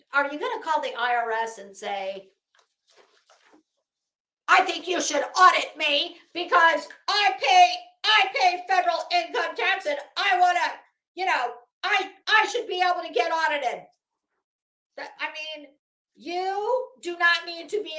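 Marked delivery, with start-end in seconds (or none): 4.5-13.8 raised, louder mock plea; mock caller voice. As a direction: ham up a sincere confession. Mock call to the IRS.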